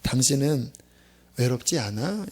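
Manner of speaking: gentle question. Retold or imitated. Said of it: "의미심장한 질문을 하더라고요".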